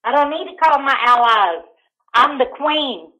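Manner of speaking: ordinary talk, nothing marked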